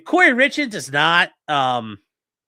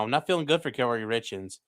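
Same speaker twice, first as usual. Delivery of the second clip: quiet repeat; 5 semitones lower, 6 dB quieter than usual. Real, not acted. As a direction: lower and softer, muttered to himself. Repeats complaint to himself.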